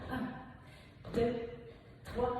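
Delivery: normal talking voice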